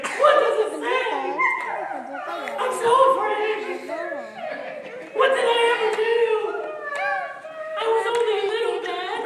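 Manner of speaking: high, loud, fast whiny panic; hammed-up frightened king. King pleading about the writing, high whiny mock fear. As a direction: whine like a scared naughty king.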